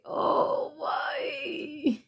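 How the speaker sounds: choked, breaking cry; real tears breaking through mid-reading; tearful and overwhelmed. Real, not acted. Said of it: "Why am I fucking crying?".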